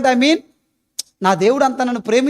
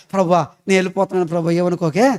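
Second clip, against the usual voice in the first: low, soft and fast brush-off to God; mock rushed prayer; 7 semitones lower, 3 dB quieter, faster than usual. Preacher imitating a busy housewife's prayer, fast and low. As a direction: gabble a quick goodbye to God, mocking. Mocking a hurried excuse prayer.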